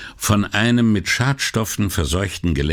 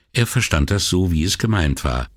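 plain reading voice

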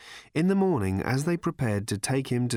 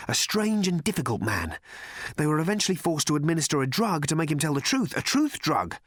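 high, shaky raving man; a man half out of his mind; feverish journalist; 5 semitones higher than usual. Acted.

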